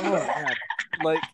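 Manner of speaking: ordinary talk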